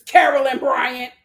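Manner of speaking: normal talking voice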